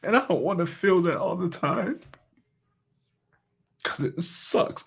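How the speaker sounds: real emotion welling up while remembering; emotional, near tears. Real, not acted. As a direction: voice catching as the feeling comes up. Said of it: "So I am gonna get emotional".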